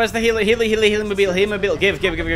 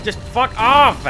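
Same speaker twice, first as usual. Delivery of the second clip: loud, slow, drawn-out angry yell; 6 semitones higher, 3 dB louder, slower than usual. Real, not acted. Gamer yelling at chasing enemies, slow loud anger.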